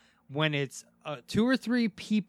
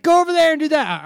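bossy command; 11 semitones higher, 9 dB louder than usual. Retold or imitated. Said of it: "she's like".

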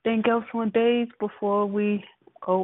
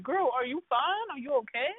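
mock concern; 5 semitones higher, 6 dB quieter than usual. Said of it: "I'm just looking like".

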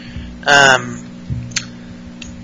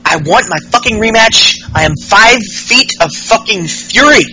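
higher, shriller mock villain demand; mock Bliss, pitched up; 5 semitones higher than usual. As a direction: repeat it higher, hammed-up fury. Bliss quoted in wrestling recap.

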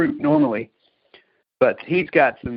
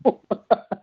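short, high, louder laugh; amused laugh; 8 semitones higher, 4 dB louder than usual. Real, not acted. Still amused about never going back, amused laugh. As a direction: let out a quick high laugh.

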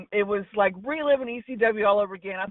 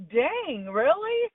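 surprised; 7 semitones higher, much slower than usual. Retold or imitated. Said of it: "And I was like".

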